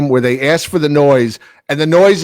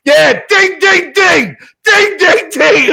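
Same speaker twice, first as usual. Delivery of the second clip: high excited yell; high shouted ding ding chant; cheering shout; 13 semitones higher, 4 dB louder, slower than usual. Retold or imitated. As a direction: whoop it up at the goal. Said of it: "I stand up and I'm going".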